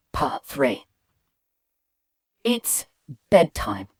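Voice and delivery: plain reading voice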